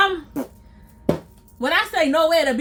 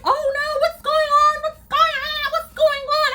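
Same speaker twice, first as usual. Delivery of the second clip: much higher, frantic imitation of a scared woman; panicked woman; 11 semitones higher than usual. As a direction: squeal it like a panicking girl. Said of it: "She's like".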